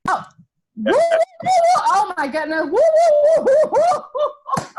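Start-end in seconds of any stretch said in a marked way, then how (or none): 0.8-1.8 high, loud, quick whoops; cheering woos. Real, not acted. Embarrassed cheer after being unmuted. 2.6-4.7 high, loud woos dissolving in giggles; whooping into laughter. Real, not acted. Cheering, breaking into laughter.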